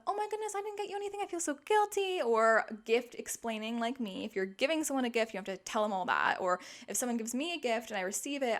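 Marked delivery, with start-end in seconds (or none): 0.1-2.2 much higher, fast, flustered gushing; flustered guilty. Retold or imitated. Receiving an unexpected gift, flustered fast and high. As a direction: blurt out the apology in a guilty rush. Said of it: "You don't have to do the".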